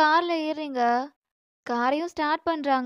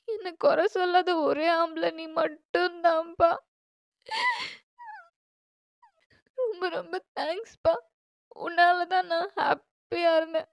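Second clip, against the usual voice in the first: higher, slowing, tearful voice; crying; 4 semitones higher than usual. Acted. Daughter thanking her father at the farewell, crying. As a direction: thank them through mounting tears. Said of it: "அழாதம்மா, அழக்கூடாது".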